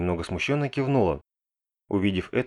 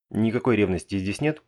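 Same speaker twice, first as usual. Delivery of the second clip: ordinary delivery, nothing marked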